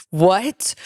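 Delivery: usual speaking voice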